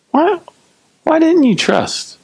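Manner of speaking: very high-pitched, drawn-out reproach; incredulous reproach. Acted. Jesus questioning the frightened disciples, high incredulous reproach. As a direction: ask it in exaggerated, squeaky disbelief. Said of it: "We can hear it that way if we want".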